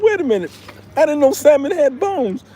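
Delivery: high, startled disbelief; shocked. Retold or imitated. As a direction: caught off guard by a bone. Bone in salmon, retold shock.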